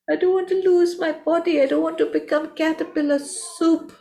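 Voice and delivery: scared caterpillar. A narrator's reading. Caterpillar protests to butterfly.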